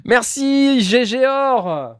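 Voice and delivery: high excited thanks. Real, not acted.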